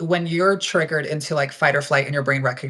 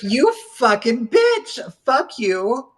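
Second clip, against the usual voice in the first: higher, slow, biting curses; furious outburst; 9 semitones higher, much slower than usual. Retold or imitated. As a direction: spit the insult out slowly. Homeless man threw away her food.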